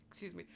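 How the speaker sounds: sudden cough, then excuse me. Real, not acted. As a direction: cough into the words. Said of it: "excuse my coughing".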